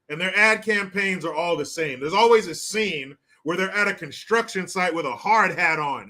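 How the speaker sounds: normal talking voice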